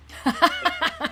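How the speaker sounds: short high burst of laughter. Real, not acted. Delighted at his own story's punchline, a short high laugh.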